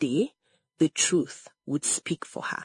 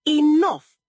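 loud, high cut-off; shouted; 9 semitones higher, 8 dB louder than usual. A narrator's reading. Mother cuts off her protesting daughter, shouted. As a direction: cut in with one forceful word. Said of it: "Egodi shouted".

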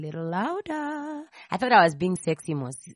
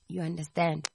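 ordinary talk

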